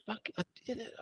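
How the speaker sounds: high, hushed self-amazed murmur; quiet amazement. Retold or imitated. Looking back at old photos, quietly amazed. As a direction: marvel softly to yourself, pitched high.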